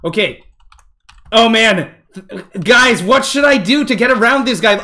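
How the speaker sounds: ordinary talk, nothing marked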